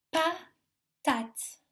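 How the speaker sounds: PATATE as pa, pause, tate; split into syllables. Shown as a demonstration. Teacher gives children a word, PATATE split in two syllables. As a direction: break PATATE into two separate beats. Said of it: "dans la première syllabe".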